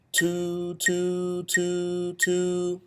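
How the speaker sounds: "Two, two, two, two" in one breath, each note tongued apart; one breath, tongue each "two" separately. Shown as a demonstration. Band teacher demonstrating articulation: each "two" tongued in one breath. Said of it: "All in one breath".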